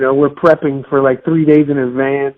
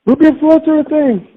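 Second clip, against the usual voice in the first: mock echo; 11 semitones higher than usual. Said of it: "I was just like".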